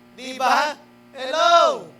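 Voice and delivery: loud, high "hello!" of disbelief; exclaiming. Real, not acted. Preaching to the congregation, loud high exclamation. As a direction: throw it out to the crowd.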